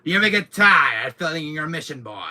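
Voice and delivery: loud, high villain taunt; hammed-up villain taunt; Wesker impression. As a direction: sneer it across the room. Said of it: "that thing from Wesker".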